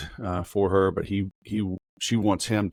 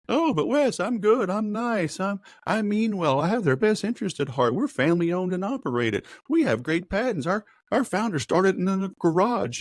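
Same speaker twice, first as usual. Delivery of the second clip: much higher, pleading sales voice; whiny mock objection; 12 semitones higher than usual. Salesperson protesting to Wes, much higher mock whine. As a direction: protest sweetly in a high put-on voice.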